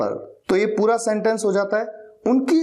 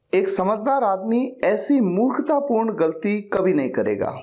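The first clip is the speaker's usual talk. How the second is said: ordinary talk, nothing marked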